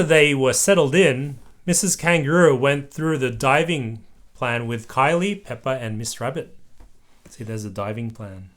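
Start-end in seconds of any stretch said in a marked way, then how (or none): none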